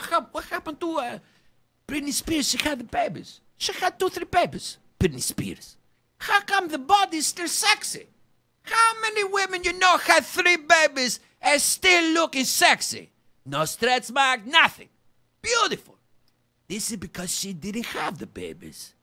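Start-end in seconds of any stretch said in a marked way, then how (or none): 6.2-16.0 loud, high, amazed praise; excited marveling. Acted. Ranter marveling at [name]'s post-baby body, loud and excited. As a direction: gush in disbelief.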